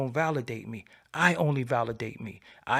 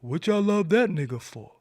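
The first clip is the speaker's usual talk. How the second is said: imitating haters; 4 semitones higher, 3 dB louder than usual. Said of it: "They be like".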